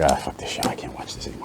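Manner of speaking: hushed grumble of a bored viewer; whispered viewer quote. Retold or imitated. Imagined viewers giving up on show.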